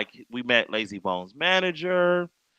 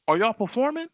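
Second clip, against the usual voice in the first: high-pitched retold query; imitated puzzled question; 9 semitones higher than usual. Lazy Bone checks on visitors with wristbands, imitated puzzled question. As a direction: question them with pitch lifted.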